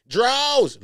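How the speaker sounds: sudden high, loud holler; imitated shout. Breaking off from reading to mimic Big U, imitated shout. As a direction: yell it out of nowhere. Blurts the screamed name from story.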